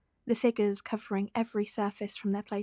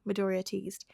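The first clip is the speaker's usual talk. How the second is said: ordinary delivery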